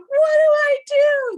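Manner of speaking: helpless mock panic; retold panic over her ruined tan; panicked question. Panicking about tan running off.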